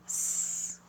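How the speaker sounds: bare "s" held as a sibilant; "s" as an isolated sound; soft S sound. Shown as a demonstration. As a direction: say only the soft consonant "s". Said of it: "to an S sound".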